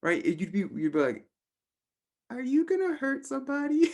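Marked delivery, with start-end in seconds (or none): 2.3-3.9 much higher, mock-worried question; hammed-up concern, very high pitched; playing an overly worried friend; alarmed friend. Exaggerated alarmed friend reacting.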